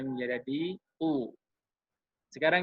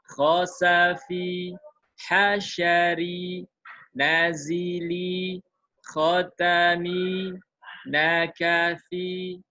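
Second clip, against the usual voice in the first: very slow, each syllable even and a bit louder; slow demo reading; 3 semitones higher, 3 dB louder, much slower than usual. Shown as a demonstration. Teacher demonstrates practice words for students, very slow and even. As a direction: stretch every word out evenly like a model reading. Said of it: "saya contohkan".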